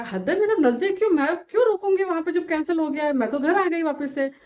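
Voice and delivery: ऊँची, ज़ोरदार आवाज़ में झुंझलाकर; गुस्से में तमतमाकर बोलो; चिढ़कर जवाब. Retold or imitated. (झुंझलाहट में ऊँची आवाज़ में जवाब)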